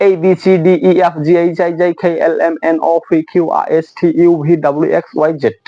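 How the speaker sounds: whole alphabet run together without pauses; in one breath. Shown as a demonstration. Teacher showing the test of correct pronunciation, one-breath alphabet recital. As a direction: rattle the letters off on a single breath. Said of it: "এক নিঃশ্বাসে এর উচ্চারণ কিভাবে করবেন?".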